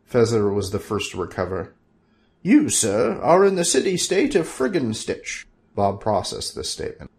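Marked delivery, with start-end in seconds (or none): 2.4-5.5 a hint of pompous sorcerer; grand wizard. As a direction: announce it proudly. Fezzer announces grandly.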